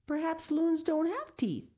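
put-on high, musing child's voice; little girl wondering. A narrator's reading. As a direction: wonder aloud like a small girl. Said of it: "thought Sal".

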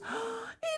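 high sharp gasp; shocked gasp. A narrator's reading. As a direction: catch your breath in dismay. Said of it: "she said sadly".

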